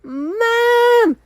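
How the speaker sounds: high, loud calling for mom; a scared kid calling mom from the stairs; retold panicked call. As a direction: yell for mom downstairs in a panic. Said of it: "I was just running downstairs like".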